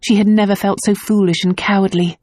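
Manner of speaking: ordinary delivery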